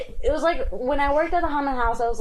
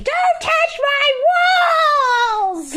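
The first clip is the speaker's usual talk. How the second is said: drawn-out screaming shriek, pitched up high; 5 dB louder, much slower than usual. Retold or imitated. Haunted house actor screams at customers: slow, high, loud shriek. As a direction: bellow it slowly at the top of your lungs. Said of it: "like, screaming".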